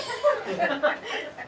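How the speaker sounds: high breathy laughing. Real, not acted. Laughing as his name is read for the scholarship. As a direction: break into laughter.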